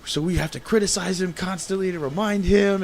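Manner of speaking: imitated rant. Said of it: "he went off".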